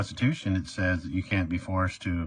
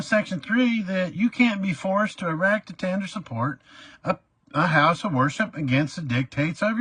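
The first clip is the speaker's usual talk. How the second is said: high, loud indignant recital; theatrical outraged citation; poured-on lecture; 11 semitones higher, 4 dB louder than usual. Retold or imitated. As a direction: hammer the constitution at the judge. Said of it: "I really poured it on".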